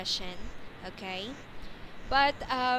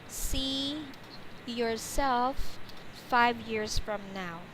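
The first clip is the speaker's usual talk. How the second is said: spaced-out phrases for a learner; slow teacher's dictation; slow model question; much slower than usual. Shown as a demonstration. Teacher models question for student to repeat.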